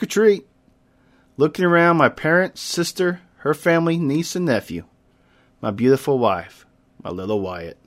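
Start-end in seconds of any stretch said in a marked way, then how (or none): none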